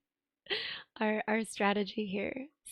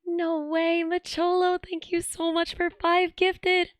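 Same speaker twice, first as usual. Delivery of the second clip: high, loud thrilled thanks; excited; 7 semitones higher, 6 dB louder than usual. Real, not acted. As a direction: gush with hype over the gift. Hype over gifted subs.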